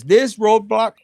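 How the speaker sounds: card's words punched out loud; loud reading. Shown as a demonstration. Reading the card to viewers, loud and emphatic. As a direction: boom out the card's first words. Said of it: "It says".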